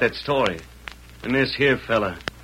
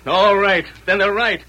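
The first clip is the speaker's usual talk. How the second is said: higher and louder, defiant; defiant anger; 6 semitones higher, 4 dB louder than usual. Acted. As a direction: spit out a bitter vow. Shad vows to turn outlaw.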